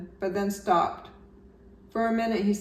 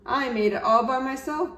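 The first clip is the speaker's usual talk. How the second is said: proudly; 6 semitones higher, 5 dB louder than usual. A narrator's reading. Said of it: "Bear said proudly".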